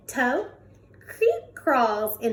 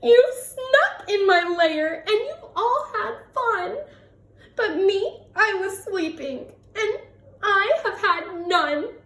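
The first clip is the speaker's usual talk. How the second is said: higher, whimpering and half-laughing sobs; blubbering bear; 9 semitones higher than usual.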